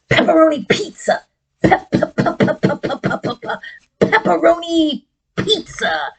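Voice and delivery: fast, stuttering puppet voice; puppet chef. Acted. Chef puppet stutters his favourite food. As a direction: stammer out the words like an excited chef.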